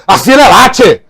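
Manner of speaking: loud, blunt bark at a customer; gruff southern shout. Retold or imitated. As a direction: shout "A fila é lá, tchê!" loudly, blunt. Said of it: "aí a pessoa".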